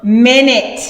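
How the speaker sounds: usual speaking voice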